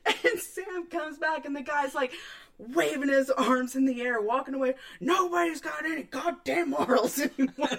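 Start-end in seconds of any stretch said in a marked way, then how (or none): 5.0-7.7 mock grumpy man. Imitating grumbling man walking away.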